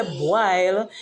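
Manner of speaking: "boil" said as "bwile"; Patois pronunciation. Shown as a demonstration. Explaining the BW spelling, "boil" as "bwile". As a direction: put a w after b in "boil", then long I. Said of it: "So when we say boil".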